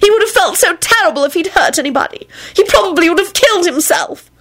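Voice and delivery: high-pitched, upset pleading; pleading woman. A narrator's reading. Woman defending her friend to the men, high-pitched pleading. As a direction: defend him in a high, distressed voice. Elizabeth pouting, defends the werewolf.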